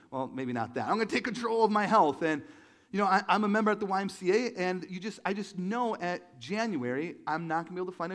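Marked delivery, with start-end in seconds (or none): 0.9-2.2 loud, hammed-up determination; mock resolve. People vowing to get healthy, mock resolve. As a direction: proclaim it like a big resolution. Acting out New Year resolution.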